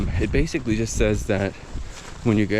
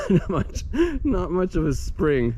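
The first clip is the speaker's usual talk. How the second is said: laughing; 10 semitones higher than usual. Real, not acted. Laughing at the frozen spring.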